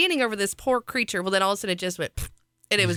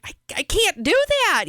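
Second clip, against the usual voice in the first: mom imitating her own panic; panicked protest; 12 semitones higher than usual. Retold panic to husband.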